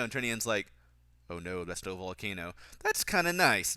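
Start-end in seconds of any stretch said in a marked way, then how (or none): 1.3-2.5 low, soft imitation of a cheesy movie seaman; understated ominous mock line; Drinian. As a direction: drop low and quiet, lightly in character. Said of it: "Drinian's accent's still really cheesy".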